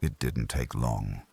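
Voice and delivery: ordinary delivery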